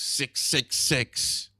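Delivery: slow, eerie mock chant of six six six; ominous intone. Joking about the mark of the beast, slow eerie intoning. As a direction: drag it out like a doom prophecy.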